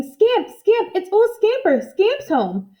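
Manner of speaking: high, joyful shout of the dog's name; excited shouting. A narrator's reading. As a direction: call out thrilled across the yard.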